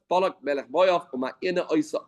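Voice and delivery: ordinary delivery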